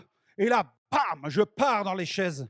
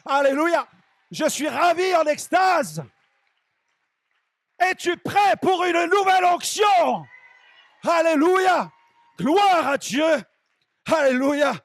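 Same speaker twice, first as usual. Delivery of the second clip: high, full-force revival shouting; ecstatic preaching shout; 8 semitones higher, 7 dB louder, slower than usual. Real, not acted. Preacher rousing the congregation after the vision, ecstatic high shout. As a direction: cry out praise at the top of your voice.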